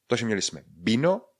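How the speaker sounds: ordinary talk, nothing marked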